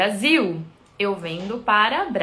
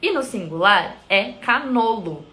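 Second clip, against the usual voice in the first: normal talking voice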